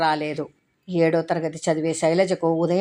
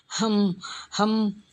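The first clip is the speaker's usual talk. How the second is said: muffled sob hums; 4 semitones higher, much slower than usual. A narrator's reading. Sounds of a girl crying into her hands at night. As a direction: hum out a muffled, held-back cry. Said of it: "సన్నగా, చిన్నగా".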